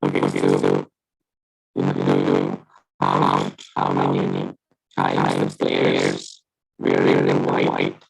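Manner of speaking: robotic, glitchy deep voice over the call; bad connection. Real, not acted. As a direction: voice breaking up like a robot. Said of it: "You're glitching".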